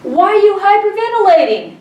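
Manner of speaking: high, loud nurse's demand; incredulous nurse. Retold or imitated. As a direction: imitate a nurse's loud, high-pitched disbelief. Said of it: "She goes".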